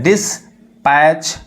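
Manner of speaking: Dispatch drawn out slowly, sound by sound; slow blended reading. Shown as a demonstration. Teacher blending a word slowly for students. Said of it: "आप मिलाकर पढ़ सकते हैं".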